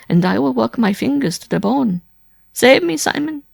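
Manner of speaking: high, loud pleading woman; desperate, near sobbing. A narrator's reading. Wife begging Simon to protect her, tearfully desperate. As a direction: beg for rescue, building toward sobs. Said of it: "could no longer suppress her sobs".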